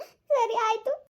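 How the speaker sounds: high, drawn-out giving in; slow, high-pitched resigned okay; reluctant assent. Acted. Distressed wife gives in to elders.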